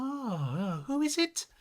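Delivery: high baffled question; high mock confusion; puzzled customer. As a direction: act lost and confused. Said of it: "She's like".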